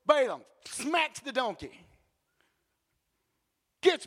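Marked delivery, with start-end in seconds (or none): none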